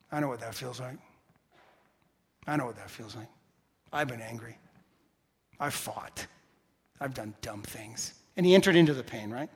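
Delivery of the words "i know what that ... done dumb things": low, soft confiding admission; hushed, low, with pauses between admissions; quiet empathy. Retold or imitated. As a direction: imitate a friend gently sharing the pain. Friend's empathetic reply, acted out.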